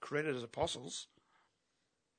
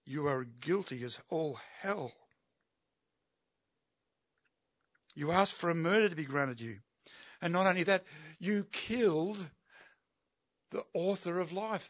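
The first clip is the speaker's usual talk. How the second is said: accusing preach; 3 semitones higher, 5 dB louder than usual. Acted. Preacher voicing Peter's accusation.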